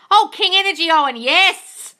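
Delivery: loud, high shout-out; high, excited welcome; effusive greeting. Real, not acted. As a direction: hail a follower with loud delight.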